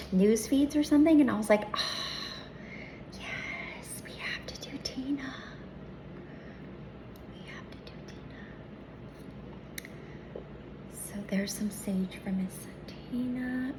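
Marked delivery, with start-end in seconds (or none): 1.7-8.4 hushed eager whisper. Retold or imitated. Excited whisper to herself. 10.9-13.7 whispering. Real, not acted. Sage offering, whispered.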